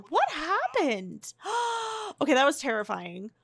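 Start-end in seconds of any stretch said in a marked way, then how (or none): 1.4-2.1 gasp. Real, not acted. After the attack.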